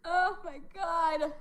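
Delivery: high and slow after laughing; laugh-drained sigh. Real, not acted. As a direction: gasp it out, spent from laughing. Still laughing.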